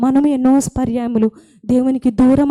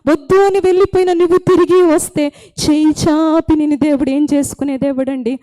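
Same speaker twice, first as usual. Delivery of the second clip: raised, fervent sermon delivery; impassioned preaching; 4 semitones higher than usual. Acted. Preacher pleading with congregation over music, fervent. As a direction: preach it up with urgency.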